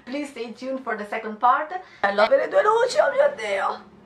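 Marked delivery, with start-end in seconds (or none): 2.0-3.9 much higher, louder delighted cry; thrilled squeal of joy; excited. Real, not acted. Entusiasta delle due luci. (gridolino entusiasta)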